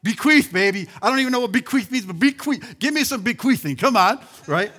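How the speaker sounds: loud, fast, hyped-up shout of bequeath; rally the church with a loud, gleeful bequeath; excited shout. Real, not acted. Preacher firing up his congregation, a loud excited shout.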